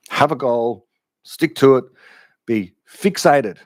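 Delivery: short commands, each set apart by pauses; Jocko Willink impression. Imitating self-help people giving orders, emphatic motivational voice. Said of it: "Jocko Willink talks like this".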